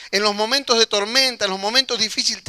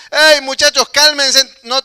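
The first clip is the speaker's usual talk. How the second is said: higher, louder reassuring shout; Jesus shouting to his disciples to calm down, loud and high; 5 semitones higher, 9 dB louder, slower than usual. Retold or imitated. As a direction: yell over the storm so they stop panicking. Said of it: "Jesús ahí gritándole también".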